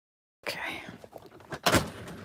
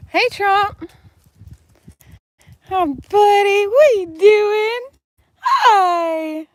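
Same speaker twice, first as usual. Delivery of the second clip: high pet talk; 10 semitones higher, 4 dB louder, slower than usual. Real, not acted. Greeting her goat Trump with high, loud, stretched baby talk.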